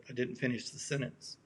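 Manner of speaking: ordinary talk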